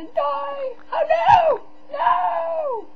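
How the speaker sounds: high, loud cry of alarm; panicked scream. Real, not acted. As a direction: scream as if about to fall to your death. Panics at near death in game.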